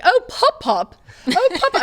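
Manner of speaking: high, puzzled "Oh, pop-up" imitating Texans; mock Texan confusion. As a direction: mimic the locals not getting the word. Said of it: "people will be like".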